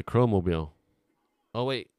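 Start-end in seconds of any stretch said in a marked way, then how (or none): none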